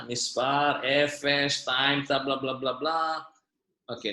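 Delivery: ordinary talk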